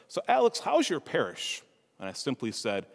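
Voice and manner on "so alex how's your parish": cousin Joe. Retold or imitated. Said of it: "my cousin Joe says".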